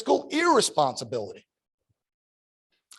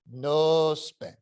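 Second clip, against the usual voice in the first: put-on "no spend" repeated at normal volume in an Italian accent, milder; softer echo. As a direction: ease off on the second "no spend". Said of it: "This heavy Italian accent".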